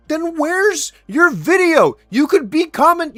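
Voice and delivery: high, loud, drawn-out demand; shouted, incredulous challenge; exasperated yelling. Real, not acted. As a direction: yell the challenge at full outrage. Yells back at the clip.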